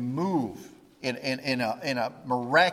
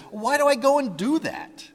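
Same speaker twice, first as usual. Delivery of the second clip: higher, pleading cry of why do I go and do that; exasperated Paul; 10 semitones higher, 4 dB louder than usual. Retold or imitated. Preacher voicing Paul's inner struggle, exasperated and pleading. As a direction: ask it like a man fed up with himself.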